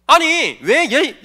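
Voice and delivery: normal talking voice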